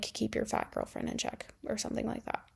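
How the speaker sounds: hushed whispered retelling of the insult; whispered quote. Retold or imitated. Retelling to viewers what she told him, whispered. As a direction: breathe it out like a secret.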